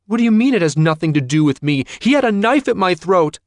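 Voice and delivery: loud, high, fast furious protest; angry outburst. Acted. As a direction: throw it back at her father. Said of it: "her expression angry".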